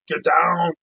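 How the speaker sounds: loud, mock action-hero bark; hammed-up action hero yelling an order; Arnold Schwarzenegger. Comedian mimics Arnold barking an order, loud mock action-hero voice. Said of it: "Arnold Schwarzenegger".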